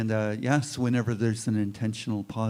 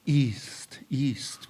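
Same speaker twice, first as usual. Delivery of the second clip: paddle sound; 3 semitones higher than usual. Shown as a demonstration. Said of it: "you can hear that sound".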